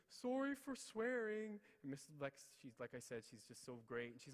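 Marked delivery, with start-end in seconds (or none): 0.1-1.6 high, loud, drawn-out mock wail; slow tearful child's apology, hammed up; imitating near-tears daughter. Daughter apologizes to teacher by phone, mock tearful wail. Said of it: "she's trying not to cry".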